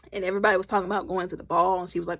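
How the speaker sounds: ordinary delivery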